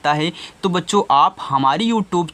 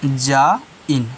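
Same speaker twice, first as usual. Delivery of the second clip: जा इन slowly, split in two; lower, drawn-out जा, then इन; syllable by syllable; 4 semitones lower, much slower than usual. Shown as a demonstration. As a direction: break it into जा and इन for learners. Breaking design into syllables.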